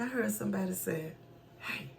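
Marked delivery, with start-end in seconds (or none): 1.6-1.9 very soft, discreet "psst"-like call; hushed call. Retold or imitated. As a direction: imitate someone quietly getting attention at a funeral. Stranger discreetly calling her at funeral.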